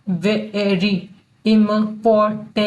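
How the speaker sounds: important very slowly, broken into pieces; im-por-tant, syllable by syllable with gaps; sounding out. Shown as a demonstration. Teacher breaking important up for learners, very slow.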